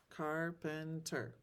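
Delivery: syllables. Shown as a demonstration. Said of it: "How many syllables are in each word?".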